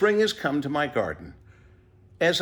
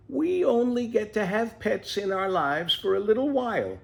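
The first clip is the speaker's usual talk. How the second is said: put-on higher, gentle old mother explaining; wise mother tortoise; 9 semitones higher than usual.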